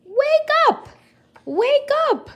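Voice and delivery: very high, loud shouting; yelled. Acted. Camilla yells at a leaf to wake up, shouting. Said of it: "grabbed one leaf and yelled".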